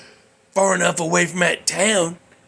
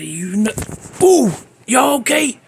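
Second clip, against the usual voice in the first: sudden shout; 7 semitones higher, 5 dB louder than usual. Retold or imitated. Priest jolted, shouts to audience.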